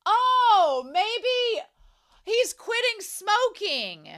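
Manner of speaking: loud, high, slow burst of a new idea; light up as the answer hits you; sudden realization. Real, not acted. Host realizing Uramichi means quitting smoking, excited and drawn out.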